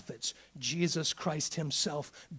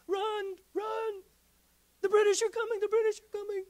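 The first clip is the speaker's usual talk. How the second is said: mock panic; 6 dB louder than usual. Acting panicked colonist alarm.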